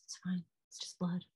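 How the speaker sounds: soft, calming murmur; retold reassurance. Husband calming his wife after the birth, soft. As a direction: soothe quietly, as if it is nothing.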